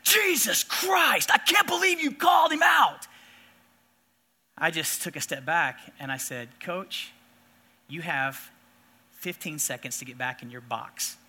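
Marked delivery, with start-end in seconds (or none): 0.0-3.1 angry shout. Retold or imitated. Coach yelling in the umpire's face, furious shout. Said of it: "and he goes". 6.6-11.2 firm low warning. Retold or imitated. Umpire warning a coach.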